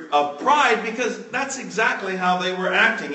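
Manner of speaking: ordinary talk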